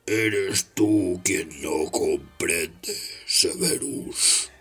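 low, very slow growl; slow, low, tense snarl. Acted.

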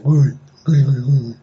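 Ghul repeated, relishing the sound; savoring a word. Shown as a demonstration. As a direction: roll Ghul around like a treat. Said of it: "Me encanta decir Ghul".

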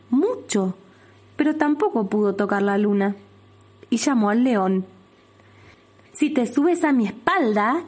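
usual speaking voice

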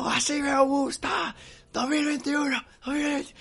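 very high, drawn-out shout to the crowd; gritando a la hostia. Retold or imitated. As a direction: re-enact rousing the neighbours at a yell. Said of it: "Y todos ahí gritando a la hostia". (grito muy agudo arengando a los vecinos)

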